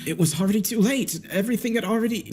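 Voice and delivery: high-pitched panicked suspect; cracking under pressure. A narrator's reading. Suspect cracks under detective's questioning, high and frantic. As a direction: blurt it out as you crack, pleading innocence. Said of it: "the guy finally cracks".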